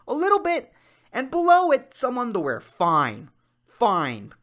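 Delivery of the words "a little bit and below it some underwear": high pleading. Pretend. Mock pleading, rising pitch.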